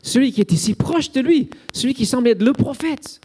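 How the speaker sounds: plus aigu, incompréhension douloureuse; question désespérée. Retold or imitated. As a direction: supplier une réponse, incrédule. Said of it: "c'est la question de Salomé". (Salomé incrédule, voix plus aiguë)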